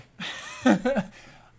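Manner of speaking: a loud high laugh; burst of laughter. Real, not acted. Laughing at his wife's suggestion, a loud high burst.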